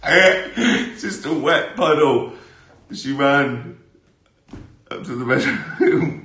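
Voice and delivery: slow, loud laughing line. Real, not acted. Laughing at his mum in the pond, slow and loud. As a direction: laugh through the words.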